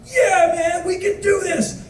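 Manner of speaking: high-pitched, choked-up rallying cry; teary. Retold or imitated. Tourist bonds with bus driver, teary and high-pitched. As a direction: get mock-weepy while cheering him on. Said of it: "now I'm getting all teary".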